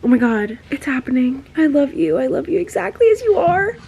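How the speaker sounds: high and loud, bursting with excitement; thrilled squeal. Real, not acted. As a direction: read it in a rising, excited squeal. Reader thrilled by love confession.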